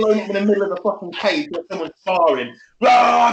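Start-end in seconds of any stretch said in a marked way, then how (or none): 2.8-3.3 loud barbarian roar; a hammed-up gym roar; mock battle roar. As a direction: bellow it like you're about to lift. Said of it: "just start raking it like that".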